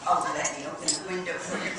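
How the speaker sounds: usual speaking voice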